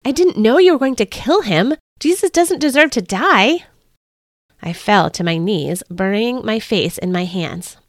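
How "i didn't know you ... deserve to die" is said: high, louder, distressed plea; anguished, high-pitched protest; blurted protest. A narrator's reading. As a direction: plead urgently for a condemned man. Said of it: "I blurted out".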